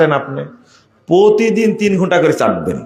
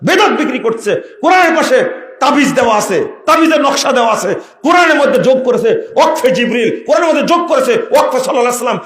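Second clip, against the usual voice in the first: mounting outrage; 8 semitones higher than usual. Real, not acted. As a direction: pile on the charges. Preacher rails at additions. (ক্রমশ চড়া ক্ষুব্ধ অভিযোগ)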